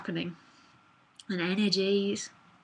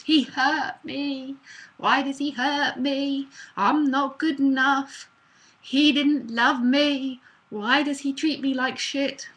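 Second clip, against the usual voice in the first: higher, slow, louder whiny lament; hammed-up self-pity; 5 semitones higher, 5 dB louder, slower than usual. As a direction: wail it like a dumped lover. Acted hurt self-pity.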